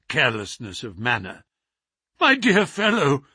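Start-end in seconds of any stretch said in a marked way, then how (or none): 2.2-3.2 cried out. Acted. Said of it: "I cried".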